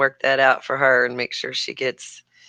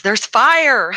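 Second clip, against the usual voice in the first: louder, higher mock alarm; child's alarmed cry; 9 semitones higher, 6 dB louder than usual. Mother retelling her kid spotting fire at the race, mock alarm.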